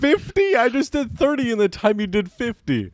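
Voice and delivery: high, stunned disbelief; shocked he did fifty; surprised. Real, not acted. Amazed at friend's fifty.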